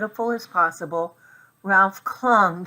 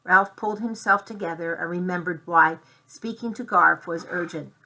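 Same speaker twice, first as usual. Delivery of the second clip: plain reading voice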